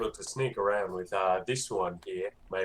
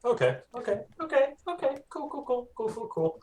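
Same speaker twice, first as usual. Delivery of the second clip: much higher, rapid okays and cools; excited squeaky okays; 13 semitones higher than usual. Real, not acted. Excited player reaction.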